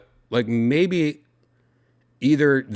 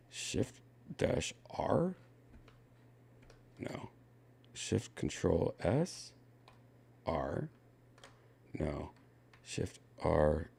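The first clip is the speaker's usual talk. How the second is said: whispered to himself; whispering; 7 semitones lower, 14 dB quieter, slower than usual. Real, not acted. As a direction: whisper the keys under breath. Muttering to himself.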